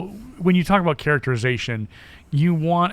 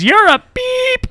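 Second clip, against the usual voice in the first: put-on high kid voice; 7 dB louder, slower than usual. Kid's line, retold.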